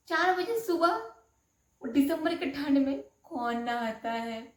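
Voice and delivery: can't believe what she's hearing; incredulous. Retold or imitated. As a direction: bride stunned at bathing at dawn, incredulous. Bride told to bathe at four.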